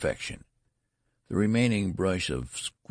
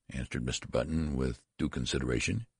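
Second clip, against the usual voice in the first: ordinary delivery, nothing marked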